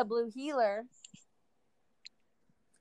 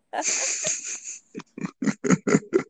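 high giggle; 4 dB louder than usual. Real, not acted. Laughs after bit.

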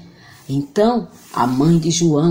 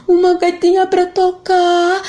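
voz de menino, alta e lenta; boy voice; 12 semitones higher than usual. Acted. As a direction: menino respondendo de longe. João. (voz de menino)

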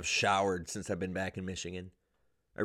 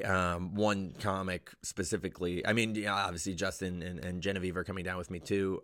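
usual speaking voice

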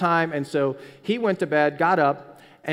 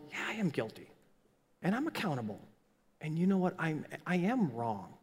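quiet confession; 8 dB quieter than usual. Pretend. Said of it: "of going".

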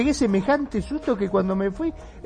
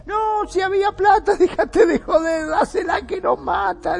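panicked yelling; 11 semitones higher, 4 dB louder than usual. Retold or imitated.

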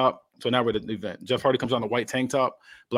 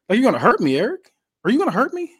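high, loud, goading questions; mocking taunt; 12 semitones higher, 7 dB louder than usual. Wrestler gets in the boss's face, mocking taunt. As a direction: needle him with a rising mock-scared pitch.

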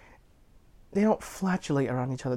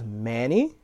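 imitated questioning name, rising sharply; retold double-take with a climbing tune; surprised recognition; 3 semitones lower than usual. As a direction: ask it as if recognising someone unexpectedly. Retold cop recognises an old acquaintance.

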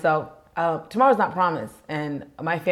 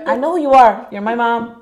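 exasperated; 6 semitones higher, 8 dB louder than usual. Retold or imitated. Retorting to mom's call.

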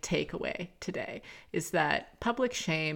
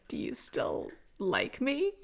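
slow, much higher, tiny hurt voice; small fragile plea; 8 semitones higher, slower than usual. Retold or imitated. To her boyfriend after a confession, small fragile plea. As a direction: replay a scared little question, lightly acted. Retelling crying on boyfriend's lap.

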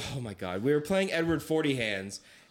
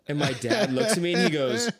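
ordinary delivery

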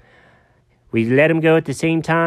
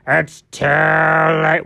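dark other voice; much slower than usual. Acted. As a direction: growl it from a dark place. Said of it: "that's my voice".

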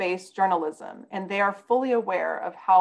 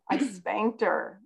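embarrassed laugh; 3 semitones higher, 4 dB quieter, slower than usual. Real, not acted. Confessing with awkward laugh.

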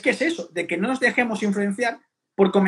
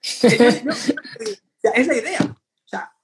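laughing through the words, higher; 4 semitones higher, much slower than usual. Real, not acted. Laughing at critics who miss the point of training. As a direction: break into laughter mid-sentence.

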